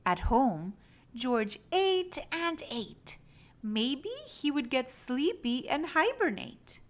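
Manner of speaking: ordinary delivery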